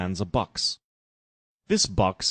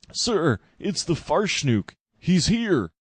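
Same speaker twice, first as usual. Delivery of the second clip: higher, slow breathless alarm; panicked news; 8 semitones higher, slower than usual. Acted. Jackboot bursting in to warn Trump, higher and panicked. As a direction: gasp out the dreadful news. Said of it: "He was out of breath".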